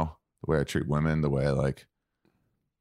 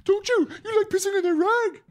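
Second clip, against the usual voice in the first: much higher and louder, cooing pet voice; 4 dB louder than usual. Real, not acted. Owner teasing his dog about the rug, in high cooing baby talk.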